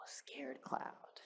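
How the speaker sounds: hushed, breathy whisper; scared whisper. Retold or imitated. Mother voicing her son, whispering scared cloud. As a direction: whisper it like you're frightened.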